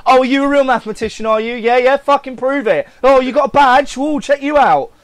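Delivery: loud, high, goading know-it-all; mocking challenger. Challenging someone wearing a maths badge, mocking and scornful. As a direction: taunt them like a comic shop pedant. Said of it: "like, every dickhead in a comic book shop".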